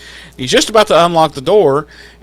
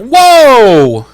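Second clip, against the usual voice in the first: high loud whoa; shocked whoa; 10 semitones higher, 8 dB louder than usual. Real, not acted. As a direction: react in mock shock. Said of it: "it's like".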